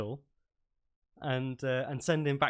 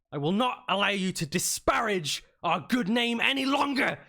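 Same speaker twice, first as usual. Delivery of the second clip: high, raised voice giving outraged orders; shouting commander; 7 semitones higher, 4 dB louder than usual. Acted. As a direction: bellow orders at a disobedient private. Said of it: "I think shouting isn't going to do any good".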